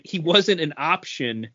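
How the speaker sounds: usual speaking voice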